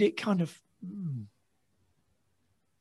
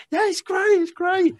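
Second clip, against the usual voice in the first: nervous reply. Retold or imitated. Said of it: "And I just said".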